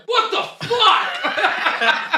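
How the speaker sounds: drawn-out high shocked what the fuck, laughing; shocked outburst. Retold or imitated. Finding the bolts already loosened, a slow high laughing gasp. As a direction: stretch it out in disbelief under the car. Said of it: "you're like".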